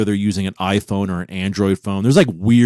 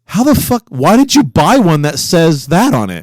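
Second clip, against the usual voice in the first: high, loud, rapid disbelief; incredulous; 9 semitones higher, 4 dB louder than usual. Real, not acted. Host grilling cohost over the plate, incredulous. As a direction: pepper him with baffled questions.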